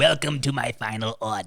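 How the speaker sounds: slow, soft sinister emperor voice; mock Palpatine. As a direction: creep it out like the emperor. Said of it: "Emperor Palpatine".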